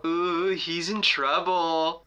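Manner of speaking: slow, drawn-out schoolkid taunt; imitated classroom jeer, stretched out; taunting classmate. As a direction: tease someone with a long singsong ooh.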